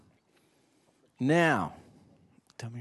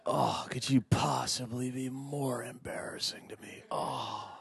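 slow, exasperated mock teen groan; teenage eye roll; 3 semitones higher, 4 dB quieter, slower than usual. Teen daughter mocked by dad, slow exasperated laugh. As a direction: play the embarrassed teen, laughing through it. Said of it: "you know that eye roll".

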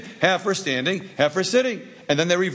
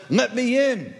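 high-pitched storybook plea; pleading; 7 semitones higher, slower than usual. Acted. Love begs Greed for a boat, pleading. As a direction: call up to the passing boat, pitch raised.

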